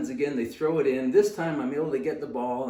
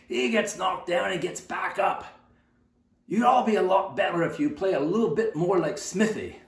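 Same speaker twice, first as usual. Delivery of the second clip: loud, raised Scottish coach voice; Mister Brown; 6 semitones higher, 4 dB louder than usual. Retold or imitated. Scottish coach praises the small kid before the team, forceful raised voice. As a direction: hold one kid up as the example, like a Scottish coach. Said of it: "Mister Brown was a Scottish guy".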